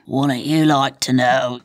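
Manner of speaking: low, put-on barfly voice; [name] in character. [name] plopped at the bar answers Tina, low put-on voice. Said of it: "plops at the bar".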